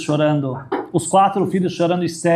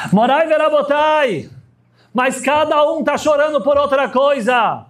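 emphatic sermon voice; preaching at full force; 10 semitones higher than usual. Acted. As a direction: rise up and call out to the congregation. Preacher raising voice to congregation.

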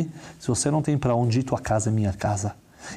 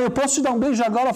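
tearful plea; 11 semitones higher than usual. Acted. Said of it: "ela entra no quarto chorando".

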